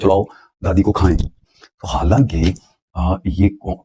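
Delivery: usual speaking voice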